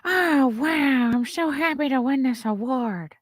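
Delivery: high-pitched put-on award-winner voice; Jennifer Coolidge. Imagining her winning speech for viewers, high imperfect impression. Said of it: "I can't do her voice".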